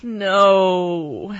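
loud, drawn-out groan; groan of dismay. A narrator's reading. Mom hears the nurse's news, groaning. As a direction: groan it long in despair. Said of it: "my mom groaned".

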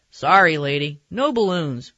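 loud, blunt barber voice; offhand barber. A narrator's reading. Barber answers mom about balloons, blunt and loud. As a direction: turn the customer down bluntly.

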